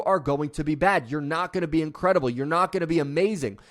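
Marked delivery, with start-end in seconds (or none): none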